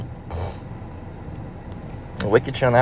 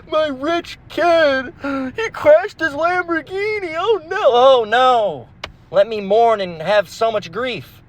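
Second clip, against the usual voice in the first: very high, slow mock wail; much higher hammed-up rich parent; rich parent parody; 12 semitones higher, much slower than usual. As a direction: ham up a spoiled rich parent's grief.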